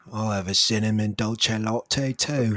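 slow British coffee order; second British caller ordering too; British accent. Retold or imitated. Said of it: "the first two were British".

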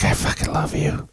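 breathy whisper, soft and intimate; hushed whisper of passion. Retold or imitated. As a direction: whisper it up close like a secret confession. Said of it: "and I could hear you".

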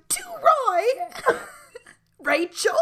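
high, loud hammed-up British accent; fake British accent. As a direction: squawk it in a bad British accent. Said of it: "he has the fake British accent".

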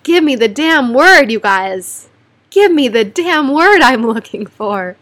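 exasperated, begging her brain; frustrated word search. Real, not acted. Stuck mid-sentence and begging viewers for a word, exasperated.

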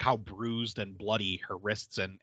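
ordinary delivery

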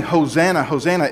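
ordinary delivery

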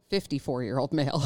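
usual speaking voice

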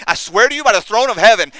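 high, forceful outburst; loud sworn oath. Retold or imitated. Peter swears to his accusers, loud vehement oath. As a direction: swear it at full voice to the whole crowd. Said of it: "We also read that Peter swore".

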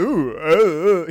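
hammed-up "ooh" imitating a silly cartoon charmer; funny cartoon character. Teasing impression of a cartoon skunk for friends, funny voice. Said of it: "He sounds funny".

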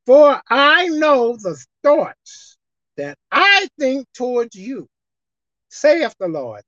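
slow scripture reading, broken into phrases. Shown as a demonstration. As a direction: stretch the words and pause after each phrase. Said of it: "let's look at it one more time".

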